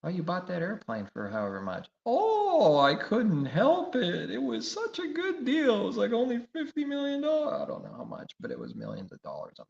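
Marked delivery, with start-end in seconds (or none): 2.0-7.6 high, loud whiny voice of a spoiled rich man; mocking rich preacher. Preacher mocking a rich man who bought a jet, high whiny squeal. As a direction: squeal out the excuse like a pampered tycoon.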